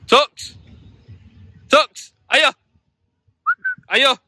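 loud high recall shout; calling the dog. Real, not acted. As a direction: shout the dog's name across a field. Calling dog back across field.

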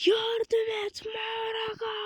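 high, very slow, crying plea; Sukuna's cry for help. Retold or imitated. Streamer mocking the anime scene, Sukuna wails for Maraka, high slow crying plea. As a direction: wail it like a beaten villain calling for rescue. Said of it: "diye bağırdı".

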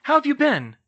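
high, loud, eager greeting; exclaimed. A narrator's reading. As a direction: greet a friend excitedly. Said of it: "Hermione exclaimed".

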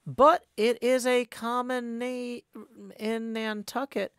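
plain reading voice

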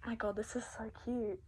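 whispered, soft and awed. Real, not acted. Trying on the mask from Tab, an awed whisper. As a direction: breathe it out in wonder.

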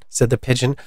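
ordinary delivery

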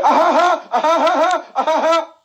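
high, loud mock cackle from the back; a mother's loud, knowing laugh; mama's fake laugh. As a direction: laugh pointedly so he knows she's there. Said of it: "and she said".

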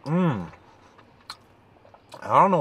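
humming while chewing; closed-mouth murmur with food; mouth full, chewing. Real, not acted. Seen 0: Bit burrito at -5; mouth closed, still chewing while pouring sauce.

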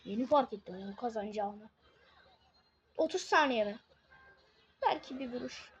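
ordinary talk, nothing marked

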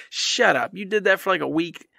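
usual speaking voice

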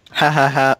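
usual speaking voice

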